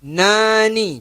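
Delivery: "Nani" dengan ujung lidah ringan; light tongue tip on "nuban"; light nun demo. Shown as a demonstration. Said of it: "Mudah saja". ("Nani" ringan)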